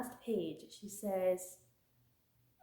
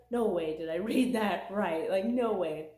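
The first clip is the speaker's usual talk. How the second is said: disbelieving; 8 dB louder than usual. Retold or imitated. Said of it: "and I was like".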